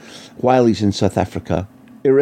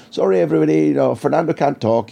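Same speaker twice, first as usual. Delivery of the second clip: raised, hammed-up official shout; mock bodyguard PR officer; 6 semitones higher than usual. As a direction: play the jokey bodyguard waving the press off. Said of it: "mock bodyguard P R officer tone".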